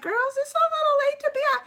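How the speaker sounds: high, quick fussing mom voice; motherly scolding. Retold or imitated. Scolding young girls out late, in a high fussing mom voice. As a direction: tut at the girls like a worried mother. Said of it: "getting all mom on 'em".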